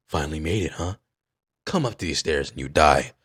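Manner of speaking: a hint of a calm villain; lightly voiced villain. Villain greeting his challenger on the stairs, lightly voiced and low. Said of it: "he's like".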